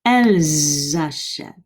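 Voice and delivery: elles achètent with a clear z linking elles to achètent; liaison demo. Shown as a demonstration. Teacher pointing out liaison, clear z link. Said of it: "hear that liaison".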